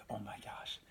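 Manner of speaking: hushed, breathless whisper of recognition, breaking into a laugh; excited whisper. Retold or imitated. Spotting David's brothers in the lounge, excited whisper. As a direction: whisper it like you've just spotted someone famous.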